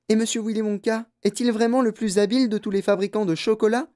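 put-on high-pitched eager child's question; wondering grandson, rising at the end; curious young boy. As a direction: ask it wide-eyed, pitch held high. Reader voices young Charlie asking.

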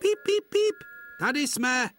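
very high, loud whistling toots; engine whistle. Acted. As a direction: sing out like a train whistle. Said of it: "hvíznul Tomáš". (vysoké hlasité hvízdání mašinky)

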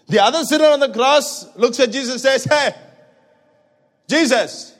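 high, loud preacher voice driving the cross story; preaching at full force. Acted. Preacher to congregation, telling the cross scene at full force. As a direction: pour it out to the church at full volume.

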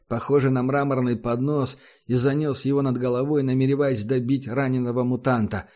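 ordinary delivery

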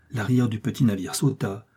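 plain reading voice

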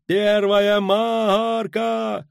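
very drawn-out high holler; slow, far-carrying lookout cry; sailor's bawled call. A narrator's reading. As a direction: bawl the depth, holding the last word long. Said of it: "заорал матрос с лотом".

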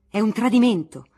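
loud, outraged shout; angry councillors clamouring over a betrayal. Acted. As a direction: yell it like a betrayed mob. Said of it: "vociferavano i più accaniti".

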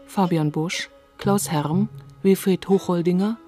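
ordinary delivery

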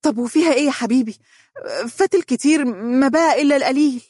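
high-pitched, uneasy pleading; mildly nervous girlfriend voice; tense. A narrator's reading. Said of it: "دنيا بتوتر".

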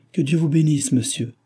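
moved old woman's grateful cry, lightly acted; grateful exclamation. A narrator's reading. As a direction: exclaim it gratefully, pitch lifted at the start. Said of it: "s'écria la reconnaissante vieille femme, prête à pleurer de joie".

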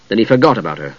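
plain reading voice

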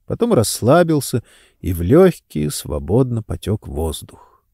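ordinary delivery

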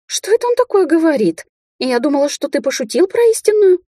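very high, whining alarm; frightened squeak. A narrator's reading. She-wolf questions her man about true mate, squeaky fright. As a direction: squeal the plea, upset and pleading. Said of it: "испуганно пропищала волчица".

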